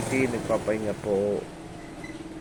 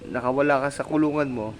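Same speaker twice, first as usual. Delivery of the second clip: lightly raised sing-song voice for a pet; 5 semitones higher than usual. Real, not acted. Owner cooing to her dog Sweetie, in sing-song baby talk.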